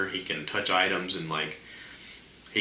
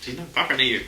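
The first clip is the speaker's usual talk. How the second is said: much higher exasperated snap at the speaker; annoyed retort; 7 semitones higher than usual. Retold or imitated. Scolding the Alexa on the table, exasperated high retort. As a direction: tell the gadget off. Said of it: "It's like".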